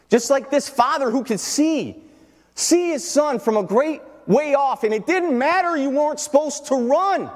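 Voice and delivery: high, driving sermon voice; full-force preaching. Acted. Preacher on the father who saw his son, full-force preaching. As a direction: lift it into a pulpit climax.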